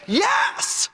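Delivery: high, jubilant shout of yes; excited cry. Real, not acted. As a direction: burst out with joy. Preacher exclaiming about answered prayer.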